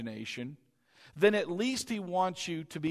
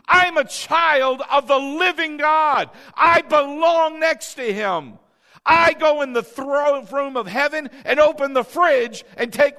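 preaching at full force; 8 semitones higher, 9 dB louder than usual. Acted. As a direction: pound the conviction into the congregation.

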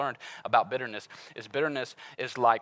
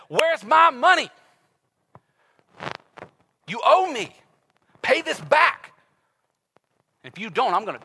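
mock menacing demand; 11 semitones higher, 11 dB louder than usual. Servant threatens his old buddy over thirty bucks, loud. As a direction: lean on him like a debt collector.

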